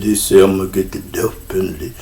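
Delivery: low, subdued mumble of a spaced-out man; dazed retold reply. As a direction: imitate a stunned friend, softly and low. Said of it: "He just gazing into space".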